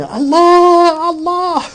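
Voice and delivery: high, piercing cry of Allah; anguished scream. Retold or imitated. Taxi driver screaming for help, high anguished cry. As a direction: wail the name in despair.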